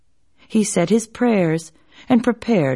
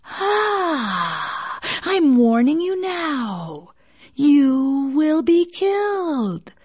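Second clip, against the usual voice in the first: slow, high menacing snake voice; basilisk; 7 semitones higher, slower than usual. A narrator's reading. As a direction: threaten slowly like a serpent. Snake threatens.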